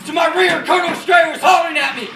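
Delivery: high, loud, urgent war narration; shouted battle account. Acted. Officer recalling his men frozen in the ditches, shouting. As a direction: shout the story over the battle.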